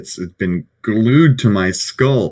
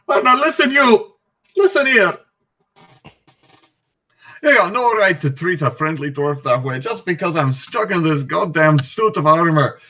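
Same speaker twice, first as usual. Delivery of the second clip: higher-pitched, blustering dwarf protest; indignant dwarf; 6 semitones higher, faster than usual. Acted. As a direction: tell them off as an affronted dwarf. Player's dwarf scolds the gnome.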